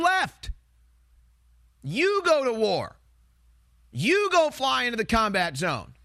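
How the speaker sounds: real indignation, pitch pushed high; heated, high-pitched challenge, stretching the final commands; indignant rant. Real, not acted. Host telling war supporters to go fight themselves, indignant.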